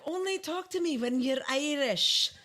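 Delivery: attempted Irish accent. Host answering a request to speak Irish, attempted Irish accent. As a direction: put on an Irish lilt that slips toward Shrek's Scottish. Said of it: "I'm sounding like Shrek, so that's a little too Scottish".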